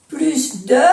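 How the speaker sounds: "Plus de" said "plys de", S clearly sounded. Shown as a demonstration. Teacher isolating "plus de" with an audible S. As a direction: hiss the S of "Plus" before "de". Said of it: "que le sigue el nombre detrás de plus de".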